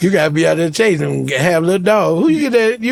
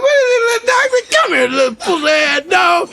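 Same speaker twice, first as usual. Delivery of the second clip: mock scornful high; 11 semitones higher than usual. Relieved mocking the tiny dog.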